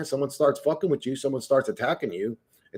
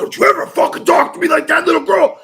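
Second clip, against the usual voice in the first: high, loud furious yell at the coworker; enraged yell; 9 dB louder than usual. Retold or imitated. Yelling at the coworker who clapped in his face, high furious shout. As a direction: scream it at her like she crossed a line.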